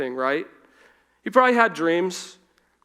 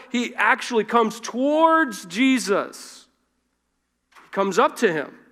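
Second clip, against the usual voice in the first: ordinary delivery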